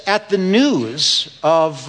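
normal talking voice

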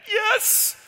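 put-on a scared kid squeaking out yes; terrified squeak. Scared bully answers dad.

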